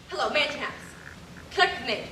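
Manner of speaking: normal talking voice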